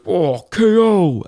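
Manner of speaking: imitating Street Fighter announcer saying K O; game announcer voice. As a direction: boom it like the arcade game announcer. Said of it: "it goes".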